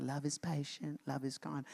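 lightly imitating a child reciting scripture; a hint of a shy child's recital; little kid reciting. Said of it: "the little kid comes up and is like".

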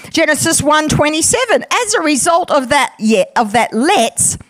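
usual speaking voice

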